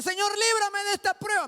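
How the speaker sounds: loud, high mock plea to God; ruego desesperado imitado. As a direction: cry out a prayer as if begging for rescue. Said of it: "no ore al Señor para decirle". (súplica imitada, fuerte y aguda)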